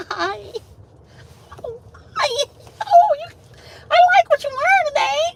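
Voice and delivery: very high, fast, fake-sweet greeting voice. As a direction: gush it out like a phony compliment.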